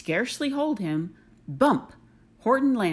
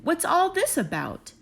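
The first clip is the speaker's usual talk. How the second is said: much higher, excited call; shouting crowd; 9 semitones higher than usual. Acted. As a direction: cry out in amazed curiosity. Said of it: "the people came shouting".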